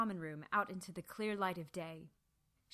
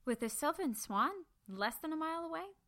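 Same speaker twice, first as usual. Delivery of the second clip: put-on higher young voice; young Bertie, higher and questioning; young girl asking; 8 semitones higher than usual. A narrator's reading. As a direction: ask him with a girl's curiosity. Bertie doubts him.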